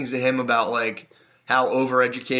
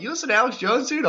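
mock amazed question, pitched way up; surprised; 11 semitones higher than usual. Driver asking about Alex Jones, mock amazed high question. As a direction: act out the driver's delighted disbelief.

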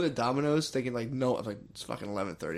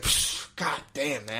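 drawn-out scoff; much slower than usual. Retold or imitated. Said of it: "he's like".

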